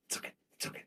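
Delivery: whispered, barely audible reassurance; acted whisper to comfort yourself; very soft, voiceless murmur; hushed self-soothing. Woman consoling herself in toxic days.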